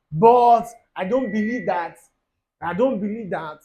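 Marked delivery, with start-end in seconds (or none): 0.1-2.0 loud, slow, exaggerated objection; a hammed-up loud protest; mock loud protest. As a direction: bellow it out slowly in mock indignation. Mocking a lukewarm believer.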